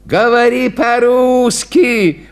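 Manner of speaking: a touch of gruff advice; advising. A narrator's reading. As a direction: tell him plainly to speak Russian. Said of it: "посоветовал Никифор".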